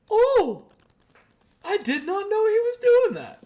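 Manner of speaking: very high, loud astonished squeal; mock surprised mom. Mom imitated discovering her son officiating, squealing high. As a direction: shriek it in shock. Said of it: "she goes".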